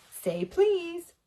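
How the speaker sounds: high, teasing sing-song. A narrator's reading. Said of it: "annoying sing-song voice".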